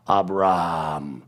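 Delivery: "Abraham" said as ab-RAAM, the R stretched instead of the H; slow "Abraham" with a long R; lengthened R. Shown as a demonstration. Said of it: "pretending that the R is a little longer".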